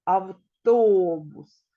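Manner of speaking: Автобус with a loud, drawn-out ТО; slow авТООбус showing the stress; stress on second syllable. Shown as a demonstration. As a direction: lean hard on the middle syllable of Автобус. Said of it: "другий склад у нас є наголошеним".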